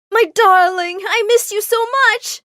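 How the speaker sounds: very high, loud, effusive cry; gushing delight. Acted. Mom throwing herself at Mister Armitage, gushing high. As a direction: squeal a joyful reunion. Mom leaps to hug him.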